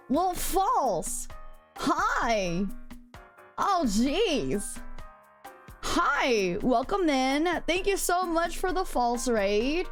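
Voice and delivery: usual speaking voice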